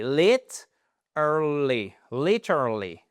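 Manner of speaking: "Lit, early, literally" split apart, stress on LIT; broken into syllables. Shown as a demonstration. Teacher breaking the word down for learners. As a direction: chop "literally" into its parts.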